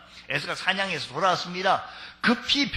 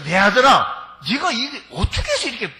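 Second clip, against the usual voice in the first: much higher, half-laughing astonished question; amazed old father; 8 semitones higher, 5 dB louder than usual. Acted. Preacher as Isaac marveling at his son's quick hunt. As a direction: marvel at how fast he caught it. Isaac asks how so fast.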